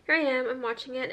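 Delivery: ordinary talk, nothing marked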